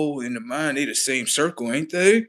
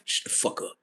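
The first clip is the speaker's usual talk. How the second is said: unvoiced whispered put-down; hiss-whisper the insult; hushed rude dismissal; whispered snap; 8 dB quieter than usual.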